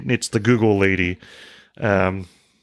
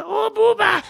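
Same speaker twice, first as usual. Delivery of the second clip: very high cooing to the dogs; high baby-talk greeting coming home; pet-name voice. Shown as a demonstration. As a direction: coo it like greeting a puppy. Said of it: "I start talking like that".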